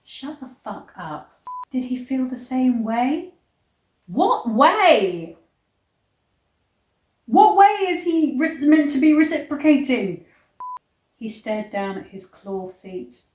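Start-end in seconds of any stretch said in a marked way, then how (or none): 0.0-1.4 muttered aside. Real, not acted. Muttered reaction to the book. 4.1-10.2 louder, higher baffled demand; rising, louder disbelief; incredulous outburst. Real, not acted. Incredulous reaction to the book.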